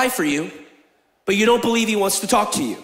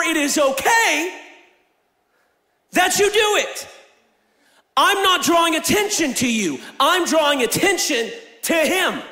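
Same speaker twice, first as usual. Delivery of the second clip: preaching at full force; 6 semitones higher than usual. Acted. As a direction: drive it home to the congregation. Sermon climax preaching.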